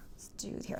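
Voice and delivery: whispered aside; whispering. Real, not acted. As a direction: whisper it to yourself. Adjuster muttering to himself.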